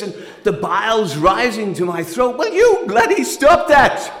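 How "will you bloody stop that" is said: loud, higher, slowed yell; exasperated shout. Retold or imitated. Man yelling at partner hitting herself, loud. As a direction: shout at her to stop. Row, she hits herself.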